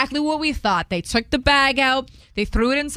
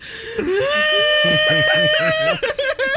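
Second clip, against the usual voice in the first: high shrieking laugh. Retold or imitated. Friend realizes cash is missing.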